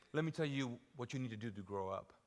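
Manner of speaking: soft and low. Shown as a demonstration. Pastor telling adult Christians, soft low earnest voice. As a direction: drop it down, earnest and quiet. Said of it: "what you need to say is".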